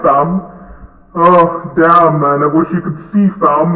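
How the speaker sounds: ordinary talk, nothing marked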